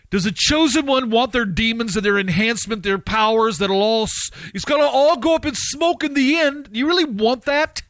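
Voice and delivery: high, challenging pulpit questions; impassioned preacher's warning; fervent preaching. Real, not acted. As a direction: challenge the congregation at full force.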